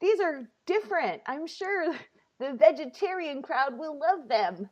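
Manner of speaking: high, strained polite approval; hesitant, high-pitched mock compliment; feigned politeness. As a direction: act out praising food you dislike. Acting polite praise of awful cookies.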